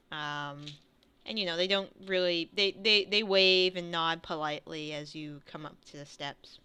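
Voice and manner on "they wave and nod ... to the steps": usual speaking voice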